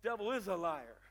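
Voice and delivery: normal talking voice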